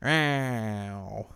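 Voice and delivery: drawn-out put-on cartoon "ow"; a comic, stretched cry of mock pain; Waluigi. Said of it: "in Waluigi speak".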